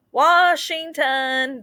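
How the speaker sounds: high, drawn-out station call; conductor's call. A narrator's reading. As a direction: stretch out "Washington" like a conductor. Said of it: "the conductor calls".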